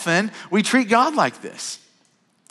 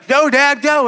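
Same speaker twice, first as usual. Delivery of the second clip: put-on higher, louder child yell; demanding little boy hollering at Dad; kid yelling; 10 semitones higher, 6 dB louder, slower than usual. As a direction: shout it like an impatient son in the back seat. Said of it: "Jones will yell".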